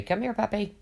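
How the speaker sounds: high sweet voice calling a puppy; coaxing puppy call. Retold or imitated. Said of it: "when I look at a dog and I say".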